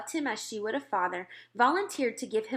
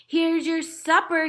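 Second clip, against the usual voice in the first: slow, higher and louder shout down to them; 7 semitones higher, 8 dB louder, slower than usual. A narrator's reading. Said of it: "he shouted".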